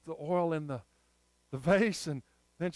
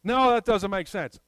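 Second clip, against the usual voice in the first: acted objection; 2 semitones higher, 6 dB louder than usual. Acts out listener's objection.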